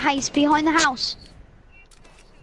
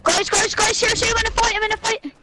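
urgent call for help; 7 semitones higher, faster than usual. Real, not acted. Calling for help in fight.

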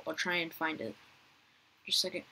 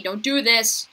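alarmed plea; 4 semitones higher, 10 dB louder, slower than usual. Real, not acted. Being trapped.

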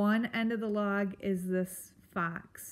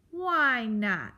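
louder, higher grumpy challenge; testy retort; 4 semitones higher, 5 dB louder than usual. Acted. Grump challenges the mouse's doubt, testy. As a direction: bark it with a falling tune.

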